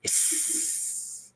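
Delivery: long soft voiceless hiss; acidic hiss. A narrator's reading. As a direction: let it sizzle like acid eating glass. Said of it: "with an acidic".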